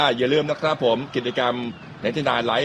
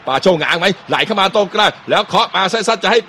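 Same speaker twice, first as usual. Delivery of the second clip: excited commentary; 7 semitones higher, 4 dB louder than usual. Real, not acted. Live play-by-play chance on goal.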